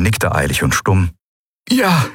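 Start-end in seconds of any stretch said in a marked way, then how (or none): 1.7-2.1 relieved yes. A narrator's reading. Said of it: "mit einem erleichterten Horntuten".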